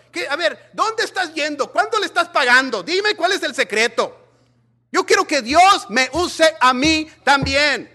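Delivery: loud, high fiery preaching; preaching at full force. Acted. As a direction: build the fire of the sermon.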